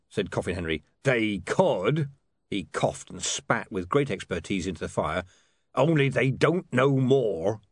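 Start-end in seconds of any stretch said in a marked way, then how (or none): 1.0-2.1 put-on a touch of a rough, sickly old beggar; lightly voiced diseased vagrant, unbothered; a hint of a wheezy street beggar; Coffin Henry. 5.7-7.6 put-on a touch of a rough, sickly old beggar; lightly voiced diseased vagrant, unbothered; a hint of a wheezy street beggar; Coffin Henry.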